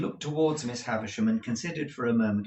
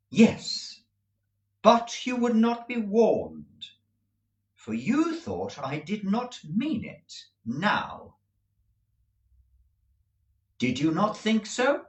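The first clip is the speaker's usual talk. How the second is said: higher, slow, detached voice; cool young woman; 10 semitones higher, 3 dB louder, slower than usual. A narrator's reading. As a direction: answer coldly and slowly. Estella voiced, cool reply.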